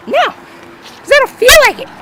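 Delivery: put-on very high, slow bratty boy voice; defiant kid. Cousin refuses to tell mom, high defiant kid voice. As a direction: squeal a drawn-out refusal like a kid.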